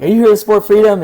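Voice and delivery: high, loud, quick, keen interviewer tone; eager question. Retold or imitated. Asking a counter protester on Facebook Live, eager and loud. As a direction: pitch the question brightly, quickly.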